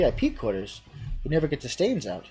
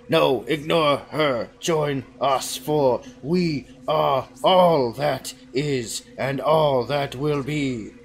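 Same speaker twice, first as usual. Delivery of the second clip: slow, louder, otherworldly; booming cult voice; 5 dB louder, much slower than usual. Acted. Cheese cult to the hero, slow booming command. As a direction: drag each word like a god speaking.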